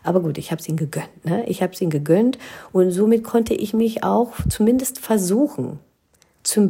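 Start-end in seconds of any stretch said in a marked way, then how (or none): none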